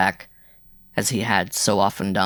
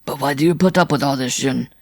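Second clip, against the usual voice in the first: a touch of anger, slightly raised; angry; 5 semitones higher, 4 dB louder than usual. A narrator's reading. Rider questioning the wretched Jean, angrily. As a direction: demand an answer with mild indignation. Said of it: "Simon LaFleur asked angrily".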